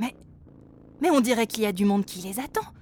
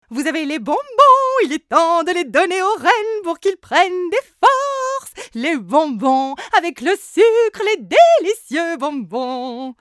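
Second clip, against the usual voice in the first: gushing candymaker; 8 semitones higher, 13 dB louder than usual. A narrator's reading. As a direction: gush over the candy. Candymaker gushes. (confiseuse gourmande et aiguë)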